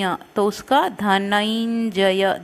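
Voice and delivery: ordinary talk, nothing marked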